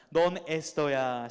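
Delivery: slow, loving declaration; tender address. Shown as a demonstration. Teacher shows students how to call a friend, slow and tender. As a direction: say it slowly as if to a beloved. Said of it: "이렇게 불러봅시다".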